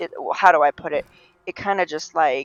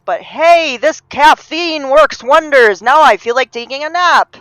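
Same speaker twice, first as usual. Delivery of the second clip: loud, high, drawn-out mock enthusiasm; exaggerated upbeat proclamation; mock-excited quote; 8 semitones higher, 10 dB louder than usual. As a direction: play up the absurd caffeine cheer, slow and loud. Acted quote of caffeine user.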